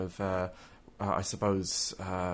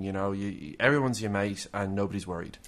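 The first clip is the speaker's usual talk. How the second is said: normal talking voice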